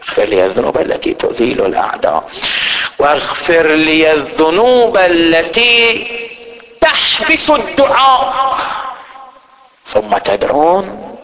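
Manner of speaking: slow, solemn chanted supplication; prayer recitation. Acted. Preacher reciting a supplication for the faithful, slow and solemn. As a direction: draw out the words of the prayer.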